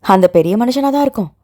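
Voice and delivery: usual speaking voice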